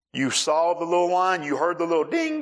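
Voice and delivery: husband's mock tirade about the gas light; mock scolding rant. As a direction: ham up the husband's lecture, pitch climbing.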